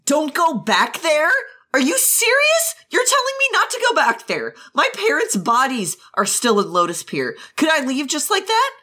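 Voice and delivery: high-pitched, incredulous outrage; angry disbelief. Acted. Jiang Cheng arguing to return for his parents, angry disbelief. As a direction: throw the words back in indignant disbelief. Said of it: "his anger, now he's mad".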